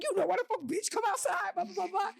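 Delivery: high, heated challenge; squaring up, daring her out; sister's challenge. Retold or imitated. Sister calling the girl outside, heated.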